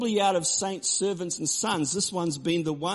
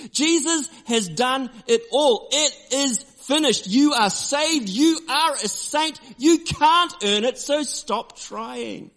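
high, loud, slow proclamation; forceful pulpit crescendo, softening on the last words; preaching climax; 9 semitones higher, 5 dB louder, slower than usual. Acted. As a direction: declare it like the altar call.

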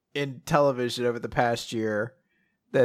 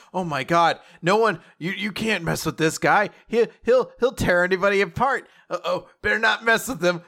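higher, louder mock panic; alarmed bystanders; 5 semitones higher, 4 dB louder than usual. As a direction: blurt a hammed-up frantic warning. Imitating alarmed crowd warning about bully.

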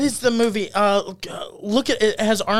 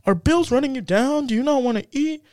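sales pitch; 8 semitones higher than usual. Acted. Storyteller voices the camp's pitch to broke people, high sales-pitch voice. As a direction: lift it up like a commercial recruiting people.

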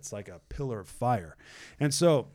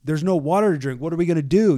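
loud, higher panicked yelling at Moses; freaking out; 5 semitones higher, 10 dB louder than usual. Retold or imitated. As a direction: yell it like a scared crowd that has run out of water. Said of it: "they freaked out".

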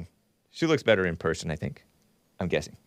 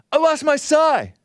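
much higher, louder cartoon turtle voice; Raphael impression; 5 dB louder than usual. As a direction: belt it out as a teenage cartoon turtle. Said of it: "that's my Raphael the Ninja Turtle impression".

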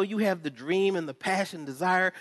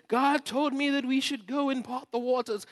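grand prophetic pastor; 9 semitones higher than usual. Retold or imitated. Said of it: "I get to say".

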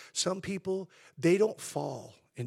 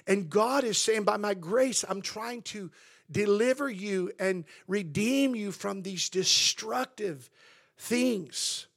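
raised, louder preaching voice; impassioned preaching; 6 semitones higher, 7 dB louder than usual. Real, not acted. Preacher telling congregation how God's grace crowns us, impassioned. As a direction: preach it with rising force, hammering each phrase. Preacher builds to full force.

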